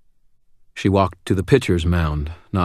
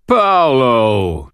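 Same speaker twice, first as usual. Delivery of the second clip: put-on singsong drunken call, raised high and loud; drunk caroling; 6 semitones higher, 6 dB louder than usual. A narrator's reading. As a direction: sing out the name like a cheerful drunk. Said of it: "Thomas caroled".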